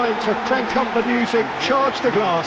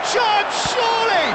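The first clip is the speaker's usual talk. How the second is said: excited shout; 13 semitones higher than usual. Real, not acted. Commentator calls shot excitedly.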